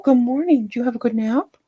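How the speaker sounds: high sing-song pet talk; puppy greeting. Real, not acted. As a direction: greet a puppy fresh from a nap. Greets puppy after nap.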